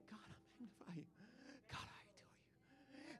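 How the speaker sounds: barely audible whispered praise; quiet group. Acted. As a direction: whisper it privately to God. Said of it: "One group's here".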